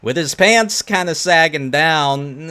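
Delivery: loud, drawn-out amazed reaction; excited. Real, not acted. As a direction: hype it up like it's wild. Reacts to clip, amused.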